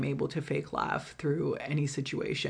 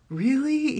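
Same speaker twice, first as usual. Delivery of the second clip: high, loud incredulous really; mock disbelief; 9 semitones higher, 5 dB louder than usual. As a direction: sound baffled in imitation. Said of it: "he was just like".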